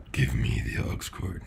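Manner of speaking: very low mock demand; deep put-on voice. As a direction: drop far down for a joke deep voice. Same demand retried in a low voice.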